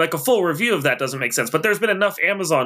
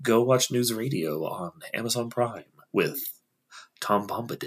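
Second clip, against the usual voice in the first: low, soft radio announcer voice; 3 semitones lower, 6 dB quieter than usual. Pretend. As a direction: drop into a mellow radio host voice. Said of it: "flows out really well in the A M radio voice".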